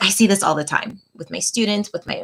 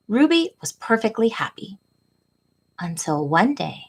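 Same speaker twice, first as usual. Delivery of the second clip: gentle, expressive picture-book read-aloud, often higher; reading to children; 4 semitones higher than usual. A narrator's reading. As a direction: read it like storytime for little kids. Reads picture book to class.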